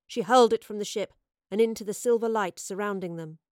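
ordinary delivery

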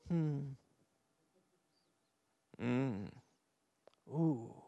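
low, quiet murmured "hmm"; small wordless nudge, barely voiced aloud; imitated soft hum. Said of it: "sometimes he just goes".

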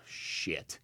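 under-the-breath unvoiced curse; a quick whispered swear word. Real, not acted. Muttered aside mid-sentence.

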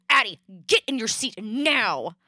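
loud, slow, forceful order; lost temper. Retold or imitated. Mother losing patience with daughter at the car, angry shout. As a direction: snap at a child, each word hit hard. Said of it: "lost my temper for a split second".

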